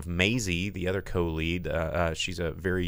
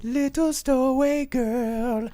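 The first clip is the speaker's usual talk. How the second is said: put-on high girl voice; slower than usual. Voicing the stowaway girl.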